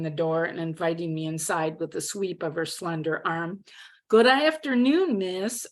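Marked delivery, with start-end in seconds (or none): 4.1-5.7 courtly Texan drawl, high on the greeting; best Texan drawl. A narrator's reading. Newcomer greeting her roommate, best Texan drawl. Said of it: "my best Texan drawl".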